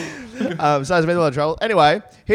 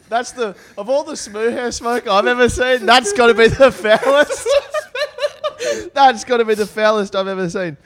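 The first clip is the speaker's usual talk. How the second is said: laughing; 7 semitones higher than usual. Real, not acted. Laughing through reading the letter.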